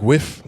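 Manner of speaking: "whiff" for "with", TH turned to F; faked accent pronunciation. Shown as a demonstration. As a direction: say "whiff" with an F ending, questioning. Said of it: "When they say, like".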